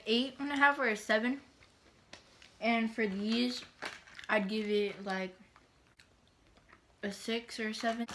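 snack stick at lips; talking between bites; chewing while talking. Real, not acted. Seen 2: Holds snack stick at lips, mouth closed mid-speech, likely chewing.

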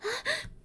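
high rising gasp; startled high gasp; alarmed gasp. Acted. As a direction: gasp as the pack drops. Backpack falls.

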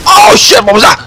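high, loud burst of alarm; startled yelp. Real, not acted. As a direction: yelp it out in sudden fright. Startled by something in the game.

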